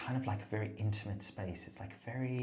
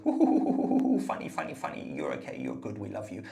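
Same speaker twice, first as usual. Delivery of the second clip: gushing audience voice; 8 dB louder than usual. Pretend. Comic plays the camera audience's gushing praise. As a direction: coo like a doting crowd. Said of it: "now they're gonna go".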